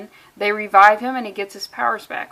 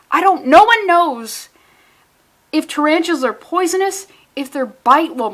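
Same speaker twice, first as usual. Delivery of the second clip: loud, high, slowed-down outburst; exasperated conspiracy rant; emphatic rant; 5 semitones higher, 5 dB louder than usual. Real, not acted. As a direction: raise pitch and volume, stretching the words. Ranting about tarantula conspiracy.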